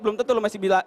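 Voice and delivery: usual speaking voice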